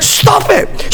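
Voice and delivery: sharp high "Stop it"; retold yell. To his crying wife, a high mock yell. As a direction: snap at her to stop. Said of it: "I said".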